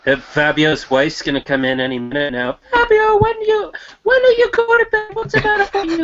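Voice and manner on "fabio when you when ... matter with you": put-on high, fast nagging wife in Italian accent. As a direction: squeal it like a scolding Italian wife. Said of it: "A Canadian doing an Italian, uh, impression".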